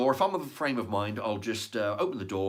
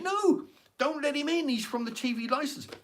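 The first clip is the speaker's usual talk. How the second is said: camera guy yelling. Retold or imitated. Camera guy warning the neighbour, yelling high. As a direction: shout to stop the neighbour opening up. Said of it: "the guy with the camera is screaming at him".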